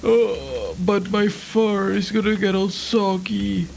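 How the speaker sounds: put-on high, slow old-person whine; whining elderly patient. Old furry patient objecting to shower time, high slow whine. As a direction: protest slowly in a high aged voice. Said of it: "imagine, like, Sushi being old".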